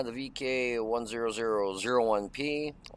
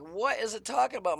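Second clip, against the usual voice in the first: much higher, confused question; baffled; 11 semitones higher than usual. Retold or imitated. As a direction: sound lost about what the game means. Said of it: "I'm like".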